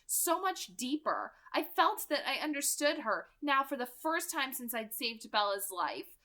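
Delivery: plain reading voice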